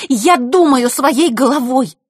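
slow, loud, high furious hiss. Acted. Scene: Zakhara hissing back at her guest, slow and furious. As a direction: spit each word slowly. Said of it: "прошипела Захарра".

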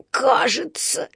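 whispery, effortful puff; пропыхтел. A narrator's reading. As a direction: puff the word out, almost voiceless. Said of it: "пропыхтел Паддингтон". (пыхтя, почти шёпотом)

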